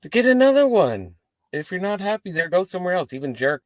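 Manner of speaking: high, loud exasperated rant; mock rant. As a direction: blurt it out, fed up with whiners. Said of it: "And it's just like".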